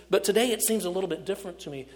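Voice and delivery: ordinary talk, nothing marked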